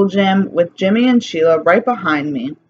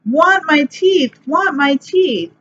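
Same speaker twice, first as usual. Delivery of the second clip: put-on much higher, slow wailing child; screaming toddler; 9 semitones higher, slower than usual. A narrator's reading. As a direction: demand it like a bawling toddler. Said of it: "Fudge started to scream".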